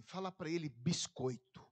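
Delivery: low, slow, lightly voiced divine whisper in the ear; a hint of God speaking low and slow; God murmuring into his ear. Deus falou no ouvido.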